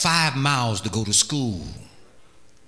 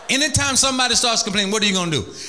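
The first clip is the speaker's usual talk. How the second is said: higher and faster, pressing the congregation; rousing call; 6 semitones higher, faster than usual. Shown as a demonstration. Preacher drills the church on answering complaints. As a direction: drive the question home. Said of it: "Can you all practice that?".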